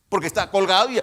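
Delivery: loud, high, breaking into gasps; acted breathlessness. Preacher showing why a hanging man cannot shout, gasping loudly. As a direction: say it, then heave for air.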